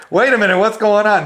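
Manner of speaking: loud, high alarmed cry; sudden alarm at the first blast; wait, what's that; startled alarm. Retold or imitated.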